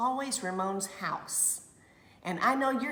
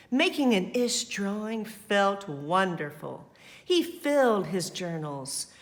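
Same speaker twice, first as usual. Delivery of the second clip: lively, louder read-aloud voice; story narration; 2 semitones higher, 6 dB louder, slower than usual. A narrator's reading. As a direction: read it to the children with energy.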